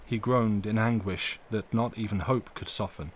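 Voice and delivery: ordinary delivery, nothing marked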